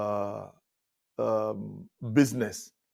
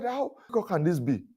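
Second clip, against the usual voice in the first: much higher but softer bewilderment; a baffled guest, higher and softer; bewildered; 6 semitones higher, 5 dB quieter than usual. Retold or imitated. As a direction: wonder aloud, baffled. Amazed it was so easy.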